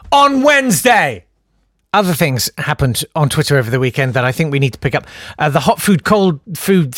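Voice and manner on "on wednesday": mock teacher announcement. Host ends mock class detention.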